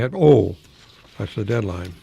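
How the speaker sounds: usual speaking voice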